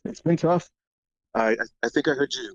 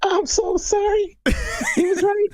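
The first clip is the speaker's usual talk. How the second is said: mock-sincere condolence through a voice changer; synthesizer voice. Real, not acted. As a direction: caller apologizing through a high pitch filter. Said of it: "I'm on one of those, uh, synthesizer things".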